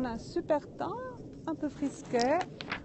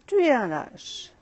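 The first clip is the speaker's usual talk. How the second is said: echo reply; 3 semitones higher, 9 dB louder than usual. Acted. Mountain echo answering the angry boy, louder. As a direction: echo throws the insult back, louder. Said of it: "la voix lui répond".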